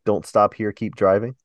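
ordinary delivery

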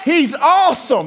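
loud high whoop of delight; real burst of excitement; excited cheer. Real, not acted. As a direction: hype the crowd up. Hyping up a named person.

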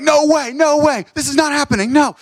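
high, excited cry of disbelief; panicked yelling. Retold or imitated. Students panicking at the sudden moment, high panicked yelling. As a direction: shout it in a frightened panic.

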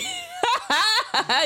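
a high, embarrassed laugh. Real, not acted. Co-host laughing at her own slip.

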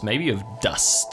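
normal talking voice

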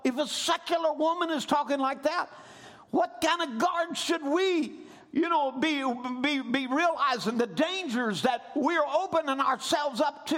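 fervent pulpit preaching; preaching at full force. Acted. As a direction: build the sermon to a shout.

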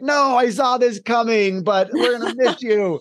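lightly voiced principal, fondly resigned; principal's fond farewell. Principal seeing off her departing teacher, fond and lightly voiced. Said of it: "she's like".

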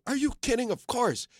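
high, eager reply; playful high-pitched quote; imitating an eager star. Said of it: "And he said".

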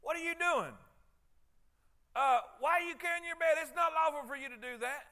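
high, loud accusing challenge; accusing Pharisees. Retold or imitated. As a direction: confront him like an outraged religious cop. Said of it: "they said".

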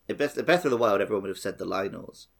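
usual speaking voice

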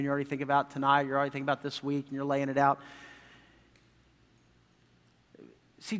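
low, soft, intimate appeal; hushed preaching. Acted. Preacher urging a distracted congregation to listen, hushed and low. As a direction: draw them in quietly, pitch dropped.

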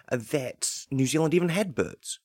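ordinary talk

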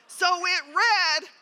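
ordinary talk, nothing marked

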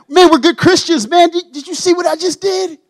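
mock a churchgoer showing off. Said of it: "We want people to think that".

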